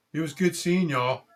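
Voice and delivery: high, loud, beaming goodbye; big-grin farewell. Acted. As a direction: smile hard through an over-friendly send-off. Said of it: "smiled real big and said".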